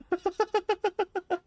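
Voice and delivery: shrill, high villain cackle far above the speaking voice; evil cackle. Acted. Vampire lord cackling at his cowering subjects, evil cackle.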